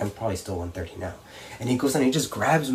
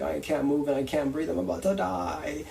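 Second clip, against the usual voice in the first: rising high, strained mock whine; mock winded panic; 5 semitones higher than usual. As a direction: imitate someone sure they're dying, pitch climbing. Mock first-time panic, winded.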